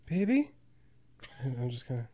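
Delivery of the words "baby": high, questioning mock pet-name call; lightly voiced, high "baby?"; imitating sleeping partner. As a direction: imitate a partner waking someone up. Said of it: "she just kinda leans over and goes".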